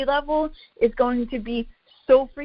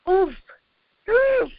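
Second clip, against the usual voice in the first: high, loud wince of a sound; recoiling "oof", pitched way up; cringing oof; 10 semitones higher, 5 dB louder than usual. Real, not acted. Trainer reacting to people lifting weights fasted, cringing. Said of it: "I'm cringing".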